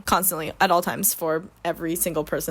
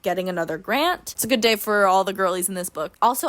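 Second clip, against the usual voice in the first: usual speaking voice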